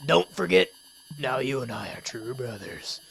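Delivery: low, hushed, creepy vow; Darunia, creepy. Acted. Goron sage bidding his brother farewell, deliberately creepy. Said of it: "make that as creepy as possible".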